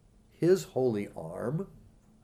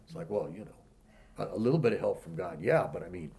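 doubtful unworthy believer; 6 semitones lower, 6 dB quieter than usual. Retold or imitated. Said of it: "Some people don't feel worthy".

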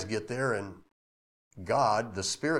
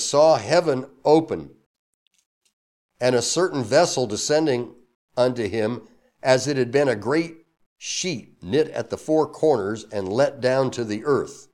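a slightly louder, formal Bible reading; scripture reading; 2 semitones higher, 6 dB louder than usual. Acted. Pastor reading the verse aloud to the church, formal. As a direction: read the King James verse out to the congregation.

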